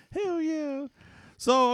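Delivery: ordinary talk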